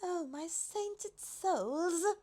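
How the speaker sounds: high, very slow, astonished giraffe voice; overwhelmed giraffe catching her breath; awed high gasp of wonder. A narrator's reading. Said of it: "gasped the giraffe".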